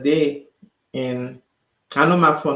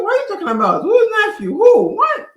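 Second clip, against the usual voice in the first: high-pitched questions, getting louder; baffled disbelief; 7 dB louder than usual. Retold or imitated. Driver baffled by sister's news, incredulous. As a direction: fire back questions, climbing to a squeak.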